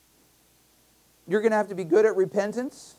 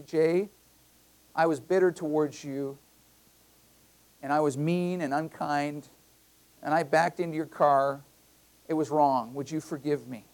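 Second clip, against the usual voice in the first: sincere confession; 5 semitones lower, 6 dB quieter than usual. Retold or imitated. Voiced sincere confession to neighbor.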